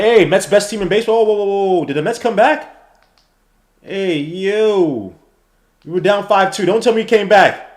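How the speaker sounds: loud, high, hyped-up yells at the game; excited shout. Real, not acted.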